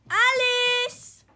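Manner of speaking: loud high call across the house; loud high call for the dog. Real, not acted. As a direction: shout the name to fetch her. Calling to the dog.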